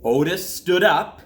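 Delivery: put-on gentle storybook reading for children; calm read-aloud to kids; story narration. A narrator's reading. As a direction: read it like a bedtime picture book. Reads a picture book aloud.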